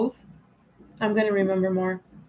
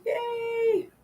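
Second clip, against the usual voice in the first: mock cheer; 13 semitones higher, 5 dB quieter than usual. Real, not acted. Squeaky cheer after announcing celibacy.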